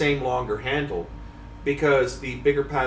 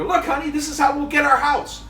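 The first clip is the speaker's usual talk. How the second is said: much higher, eager salesman voice; excited pitch to wife; 6 dB louder than usual. Retold or imitated. Husband pitching a fishing reel to his wife, eager and high. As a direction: sell her on the idea, bright-eyed and high. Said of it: "I go".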